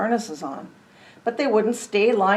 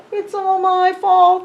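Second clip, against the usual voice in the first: crying; 10 semitones higher, 7 dB louder, slower than usual. Acted. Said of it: "she began to cry".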